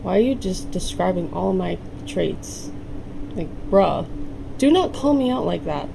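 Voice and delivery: usual speaking voice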